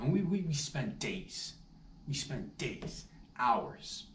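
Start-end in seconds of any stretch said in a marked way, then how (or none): none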